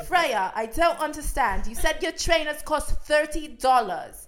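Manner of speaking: loud, slow mock-British yelling, pitched high; Joe screaming. Boyfriend challenges girlfriend in the cab over trainers, screaming in British accent. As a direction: yell an accusation in a put-on British accent. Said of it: "I would say more screaming".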